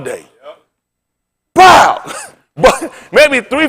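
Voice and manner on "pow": a loud, sharp "Pow!" like a marble smashing into the others; mock impact noise. Telling the crowd about his hunching marble, a loud mock "Pow!". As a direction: punch out "Pow!" as the big shooter cracks into the pack.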